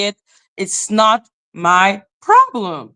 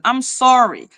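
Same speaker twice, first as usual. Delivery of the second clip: ordinary delivery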